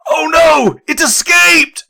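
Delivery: loud, high acted cry of alarm. Minsk's distant voice echoing through fog, alarmed cry.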